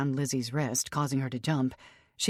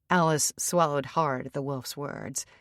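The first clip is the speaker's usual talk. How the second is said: ordinary delivery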